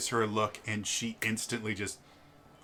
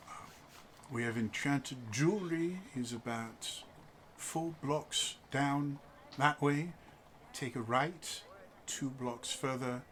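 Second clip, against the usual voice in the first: reserved husband; 8 dB quieter than usual. Acted. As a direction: give directions with stiff politeness.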